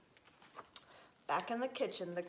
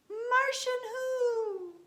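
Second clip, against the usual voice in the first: high, slow, drawn-out question; kitten calling back; 14 semitones higher, 5 dB louder, much slower than usual. A narrator's reading. Space kitten questions the stranger, high drawn-out call.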